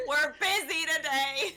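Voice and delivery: laughing. Acted. Joking reply while laughing.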